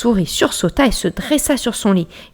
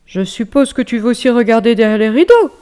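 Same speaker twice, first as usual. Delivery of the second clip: bear voice; 5 semitones higher, 7 dB louder than usual. Acted. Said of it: "fit l'ours".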